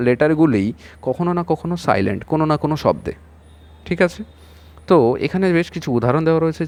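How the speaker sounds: usual speaking voice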